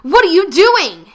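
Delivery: high, loud, slow yell; alarmed yell. Acted. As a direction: shout in alarm. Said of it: "he yelled".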